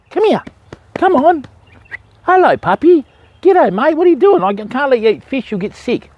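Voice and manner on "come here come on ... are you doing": high-pitched sing-song talk to a dog. Real, not acted. Fisherman calling a curious dog over, high-pitched doggy talk. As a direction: coo at the puppy in a high voice.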